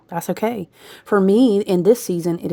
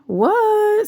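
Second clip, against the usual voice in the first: sudden high startled what; surprised; 4 dB louder than usual. Real, not acted. Hearing Spirit answer her, high startled question. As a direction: jump up in surprise.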